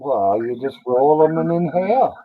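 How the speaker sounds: ordinary delivery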